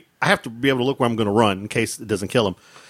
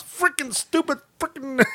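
mock yelling; 13 semitones higher, 4 dB quieter than usual. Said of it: "you hear me yelling".

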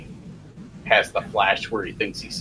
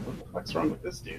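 whispered bafflement; very soft, under-the-breath disbelief; hushed aside; 6 semitones higher, 12 dB quieter than usual. Retold or imitated. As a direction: imitate onlookers whispering about a weirdo. Imitating baffled theater neighbours.

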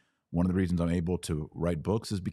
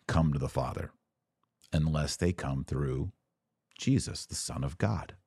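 ordinary talk, nothing marked

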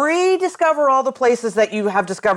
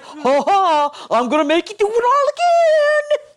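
taunting game voice; 10 semitones higher than usual. Retold or imitated. Mocking the game's taunt.

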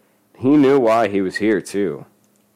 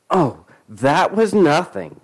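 slow, higher-pitched sarcastic interjection; chiming in; 8 semitones higher, 4 dB louder, slower than usual. A narrator's reading. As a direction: chime in, drawing it out. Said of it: "Claudette chimed in".

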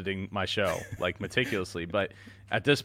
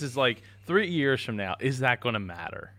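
normal talking voice

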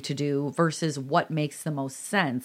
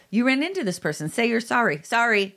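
a hint of a higher scolding parent; 7 semitones higher than usual. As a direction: play a parent telling a child to apologize. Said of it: "It's the".

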